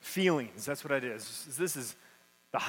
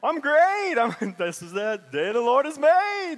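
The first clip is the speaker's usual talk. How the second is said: much higher, louder, laughing put-on brightness; hammed-up fake cheer; 6 semitones higher, 5 dB louder than usual. Parent covering chaos at church with loud laughing fake joy. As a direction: overplay the happy churchgoer, laughing it off.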